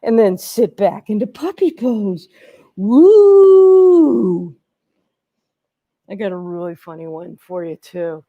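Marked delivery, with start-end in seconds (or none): 2.8-4.5 a high, loud, happy whoop; bright yelp of joy; excited whoop. Real, not acted. Cheering during a yoga pose. 6.1-8.2 hushed aside. Real, not acted. Teacher teasing the next pose.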